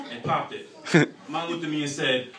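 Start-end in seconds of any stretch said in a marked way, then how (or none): none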